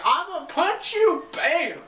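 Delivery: high, loud hammed-up squeal; play-fighting pop star impression; mock threat. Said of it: "so she's like".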